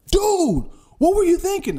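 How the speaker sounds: high, indignant yell at the driver who hit him; angry driver. Retold or imitated. Other driver yelling after the crash, angry.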